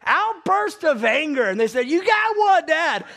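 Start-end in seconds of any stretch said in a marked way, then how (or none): 0.0-1.5 high, loud, drawn-out announcement; emphatic reading. Retold or imitated. Dad reading deeds of the flesh to kids, emphatic. Reads out his damning sin. 1.9-3.0 high, loud imitation of excited kids; triumphant kids. Kids telling dad he got one, mock-triumphant.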